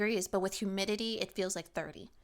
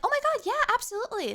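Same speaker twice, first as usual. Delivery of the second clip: eager yes; 13 semitones higher, 6 dB louder, faster than usual. Retold or imitated. Girl's eager yes in TikTok.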